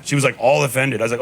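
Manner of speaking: normal talking voice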